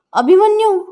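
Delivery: high, loud cry for help across the house; alarmed call. Acted. Mother calling her son in panic, loud alarmed call. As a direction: call his name out loud in sudden alarm.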